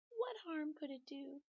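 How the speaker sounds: high, innocent-sounding pigtail. Acted. Pigtail persuading Paul, in a high innocent voice. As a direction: play it harmless and sweet.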